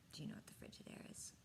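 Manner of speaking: hushed question to the listeners; whispered aside. A narrator's reading. Narrator whispers a question to the listening children.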